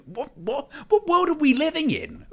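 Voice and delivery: can't believe what you're hearing; exasperated what-world-are-we-in; incredulous. Real, not acted. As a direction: pitch leaps high in disbelief. Exasperated at the pundits.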